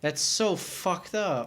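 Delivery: high, slow, stunned reaction; appalled. Real, not acted. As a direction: lean long on the words, genuinely appalled. Horrified at hearing the baby died.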